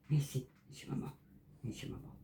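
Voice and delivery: soft unvoiced whisper; whispering over a crib. Real, not acted. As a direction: hush the little one gently. Hushing the pet to sleep.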